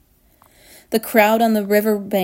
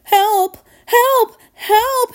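high, loud cries for help; panicked, separate high yelps; yelling; 12 semitones higher, 5 dB louder, much slower than usual. A narrator's reading. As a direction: yell for rescue. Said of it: "he yelled".

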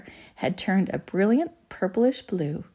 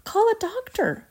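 very high urgent call; panicked shout. A narrator's reading. Father demands help for Violet, panicked shout. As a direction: shout for help, pitch leaping. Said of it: "shouted Mister Beauregard".

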